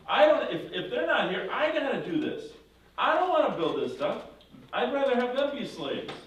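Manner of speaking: high, loud mocking tyrant voice; Pharaoh. Pharaoh scoffing at Moses' demand, high and loud mocking. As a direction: play the smug king who wants his slaves. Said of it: "what do you think Pharaoh says".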